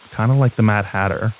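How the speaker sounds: ordinary delivery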